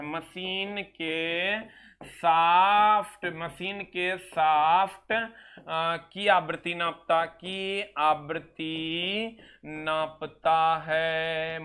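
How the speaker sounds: very slow, drawn-out dictation. Shown as a demonstration. Teacher dictating definition for notes, very slow. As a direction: linger on each word. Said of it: "इसमें लिख लीजिएगा sir".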